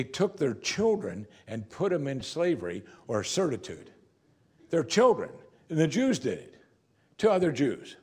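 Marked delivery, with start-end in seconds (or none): 4.7-8.0 raised, louder in indignation; preacher's indignant raised voice; outraged emphasis. Real, not acted. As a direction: drive home the outrage. Outraged emphasis on enslaving children.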